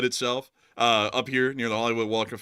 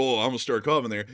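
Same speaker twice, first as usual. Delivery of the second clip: ordinary talk, nothing marked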